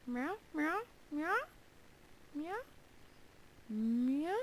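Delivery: high, silly cat meows; playful meowing. Pretend. Gamer meowing playfully to himself. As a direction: meow like a goofy cat. Random meowing while mining.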